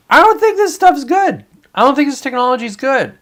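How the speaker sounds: ranting to chat, high-pitched exasperation; exasperated outburst. Real, not acted.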